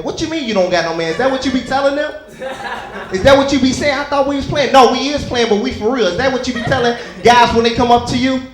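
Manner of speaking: loud, high jealous accusation; jealous outburst. Retold or imitated. Husband turning jealous during his own pickup game, loud accusing. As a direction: accuse her half laughing. Jealous role-play argument.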